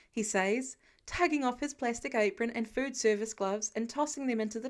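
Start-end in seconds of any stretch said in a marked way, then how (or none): none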